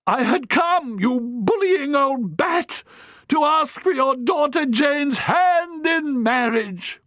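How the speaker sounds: high, slow, bursting proposal; exasperated young man. Acted. Harry blurts his proposal to the matron. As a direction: blurt it out at last.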